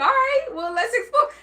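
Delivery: high, giggly go-along; laughing quote. Retold or imitated. Host voicing contestant accepting challenge, laughing.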